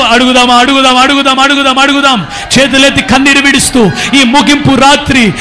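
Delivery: loud, driving preacher's call; full-force preaching. Real, not acted. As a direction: rouse the crowd with a loud call.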